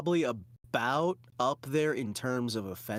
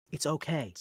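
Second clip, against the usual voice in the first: ordinary talk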